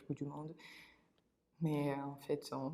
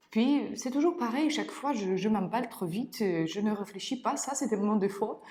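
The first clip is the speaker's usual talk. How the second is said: higher, louder burst of self-blame; exasperated self-reproach; 6 semitones higher, 6 dB louder than usual. Acted. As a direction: get worked up over your own failings. Exasperated confession of her own faults. (exaspérée contre elle-même)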